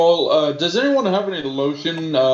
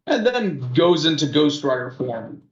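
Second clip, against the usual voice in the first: usual speaking voice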